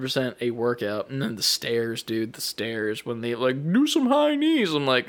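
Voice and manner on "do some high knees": high, perky-coach imitation; anime voice. Game coach demands exercise on the stairs, high anime voice. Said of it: "like, anime voice".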